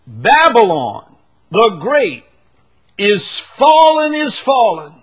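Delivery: loud, high, slow proclamation; angel's shout. Acted. Preacher voicing the angel declaring Babylon's fall, full shout. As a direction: cry it out so the whole world hears. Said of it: "This is a shout".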